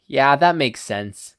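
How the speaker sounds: plain reading voice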